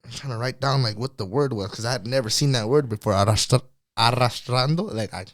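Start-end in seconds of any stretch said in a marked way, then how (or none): 4.0-4.8 "arrastrando" with the double R trilled; trilled Spanish R's on "arrastrando"; rolled R's. Real, not acted. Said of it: "you could roll your R's pretty good".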